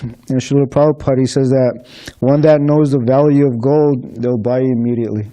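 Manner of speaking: ordinary talk, nothing marked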